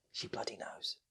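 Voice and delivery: usual speaking voice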